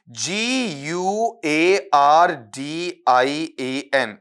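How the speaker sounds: slow letter-by-letter spelling; dictating letters to students; spelled out. Shown as a demonstration. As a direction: spell it out slowly, one letter at a time. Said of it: "spelling बोलूंगा खाली".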